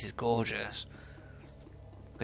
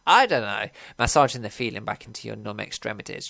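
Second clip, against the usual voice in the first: ordinary delivery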